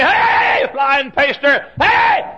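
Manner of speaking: high cheering yell at the track; retold scream. Bettor cheering his horse from the stands, yelling high. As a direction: holler for your horse to win. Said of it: "I'll scream".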